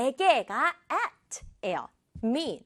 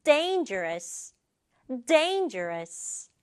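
"dangerous" slow, leaning hard on DAIN; first-beat stress; much slower than usual. Shown as a demonstration. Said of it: "첫 박자에 강조 줄게요".

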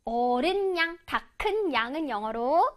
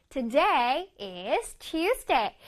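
slow model reading; much slower than usual. Shown as a demonstration. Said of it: "teacher models sentence slowly".

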